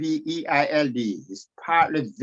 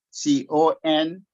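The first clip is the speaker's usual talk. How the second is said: usual speaking voice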